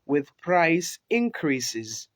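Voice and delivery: slow, each word set apart; careful slow read; slow dictation. Shown as a demonstration. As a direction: spell it out slowly for the class. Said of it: "ku tsaya ku ji shi sosai".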